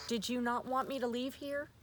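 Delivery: ordinary talk, nothing marked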